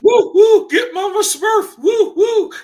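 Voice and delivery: high, loud, whooping imitation; mock excitement. Reactor mocking the father in the clip, excited whooping. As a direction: mimic his excitement with loud hooting whoops. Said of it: "He sounds like he's getting excited".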